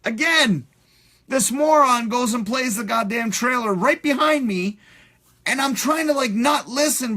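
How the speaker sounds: exasperated rant. Real, not acted. Exasperated rant about the moron.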